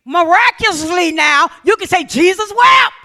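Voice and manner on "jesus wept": hammed-up loud cry to Jesus; mock shout. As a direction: shout it like sudden piety. Mock desperate prayer.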